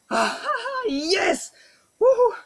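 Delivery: high ecstatic cheering whoops; joyful victory scream. Real, not acted. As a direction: scream with pure joy. Celebrating the thousandth push up. (hurlement de joie)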